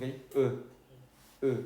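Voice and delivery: "E" with lips rounded as for a kiss; rounded French E. Shown as a demonstration. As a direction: pucker up and say "E", close to an O. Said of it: "como cuando se da un beso". ("E" francesa, labios como para dar un beso)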